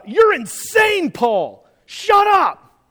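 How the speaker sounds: high, loud, slow sneering yell; mock yell. Festus shouting down Paul, high loud sneering yell. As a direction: yell him down with scorn.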